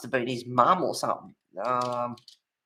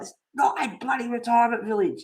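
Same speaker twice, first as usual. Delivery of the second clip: "not" and "bloody" stressed, pitched higher, a mock exasperated correction; 8 semitones higher than usual. Telling the old girl up the road it's no retirement village, mock exasperated. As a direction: hammer the "not" like you're fed up. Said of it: "It's like".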